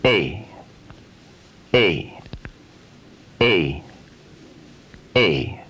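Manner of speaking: A opening then spreading to E; gliding A. Shown as a demonstration. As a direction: slide A toward E. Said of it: "end with the lips spread wider apart".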